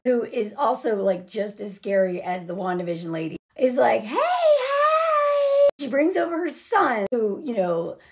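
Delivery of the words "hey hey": very high, drawn-out singsong shriek; hammed-up overfriendly neighbor calling out; mock greeting. Imitating Kathy Bates' character's greeting.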